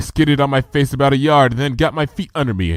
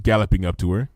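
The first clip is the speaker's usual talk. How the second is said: usual speaking voice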